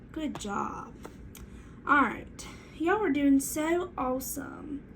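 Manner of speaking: normal talking voice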